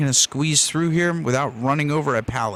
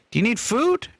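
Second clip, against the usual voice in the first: high dog talk; 9 semitones higher than usual. Real, not acted. Talking to his dog.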